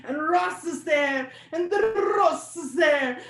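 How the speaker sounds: high, excited trill on the R of "Ross"; rolled-R Romance pronunciation. Retold or imitated. People imagining an exotic locale, "Ross" trilled excitedly. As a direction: roll the R of "Ross" with holiday relish. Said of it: "Lots of the world speaks romantic languages".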